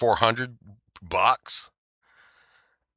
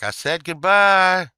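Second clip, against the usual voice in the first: louder and higher, slowed; mock taunt; 8 semitones higher, 6 dB louder, slower than usual. Judge goading him to speak, loud slow taunt. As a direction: press the goodbye as a dare. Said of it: "And he's like".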